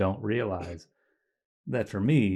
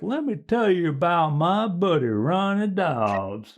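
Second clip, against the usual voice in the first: slow, higher hillbilly drawl; 8 semitones higher, slower than usual. Retold or imitated. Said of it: "he sounds like a total hillbilly redneck".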